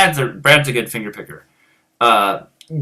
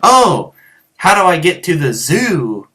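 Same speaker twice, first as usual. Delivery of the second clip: higher, louder "Oh", then the question; mock clueless tourist; 4 semitones higher, 4 dB louder than usual. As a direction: act out a silly tourist asking directions. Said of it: "It wasn't like I was like".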